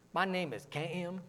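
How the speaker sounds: KIM stretched slowly into two syllables, soft; Southern two-syllable vowel. Retold or imitated. Preacher showing how the South says "Kim", slow two-syllable drawl. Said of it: "Kim is a two-syllable word".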